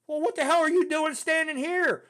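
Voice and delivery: high, loud incredulous demand; retold scolding. Sergeant Stone finding graduate at attention, loud incredulous scolding. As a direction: imitate a sergeant baffled by what he finds.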